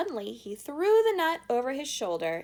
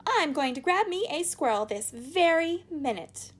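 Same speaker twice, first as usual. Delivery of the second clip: defiant; 9 semitones higher, 7 dB louder than usual. A narrator's reading. Spoilt girl defiant.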